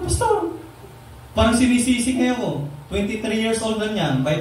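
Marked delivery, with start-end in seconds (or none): 0.0-0.6 very high, mock-weepy address; pitched-up pretend whimper; retold tearful father. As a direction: imitate a crying man appealing to his pastor. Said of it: "umiyak siya sa akin".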